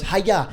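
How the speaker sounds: sharp high karate cry; karate yell. Retold or imitated. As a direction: imitate a karate kick shout. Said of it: "he goes".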